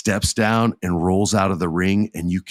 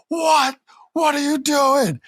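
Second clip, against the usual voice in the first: stunned disbelief. Retold or imitated. Jey's stunned reaction.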